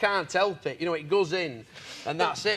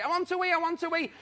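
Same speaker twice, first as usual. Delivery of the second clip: mock whiny women; 7 semitones higher, 4 dB quieter, faster than usual. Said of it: "They want to wee".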